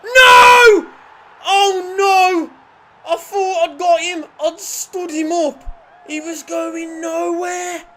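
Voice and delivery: high, loud protest with drawn-out words; anguished yell. Real, not acted. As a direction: wail in disbelief at conceding. Conceding a goal.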